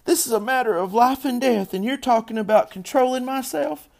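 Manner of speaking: high, loud outraged tirade; shrill-pitched indignant outburst; yell. A narrator's reading. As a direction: yell it all through as a furious little girl. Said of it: "yelled Fern".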